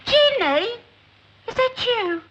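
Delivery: loud, surprised mother on the phone; calling down the line. Acted. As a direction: call out to check who's there.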